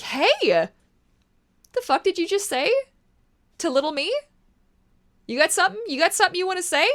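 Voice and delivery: squaring up to a bully for little me; confrontational grown-up self. Pretend. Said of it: "roll in and be like".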